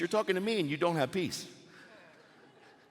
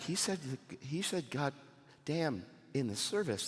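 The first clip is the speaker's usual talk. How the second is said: much softer, imitating an upset churchgoer; scandalised hushed complaint; 5 semitones lower, 10 dB quieter than usual. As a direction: hush it like a mock tattletale.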